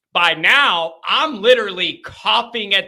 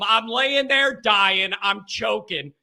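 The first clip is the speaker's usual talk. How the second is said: usual speaking voice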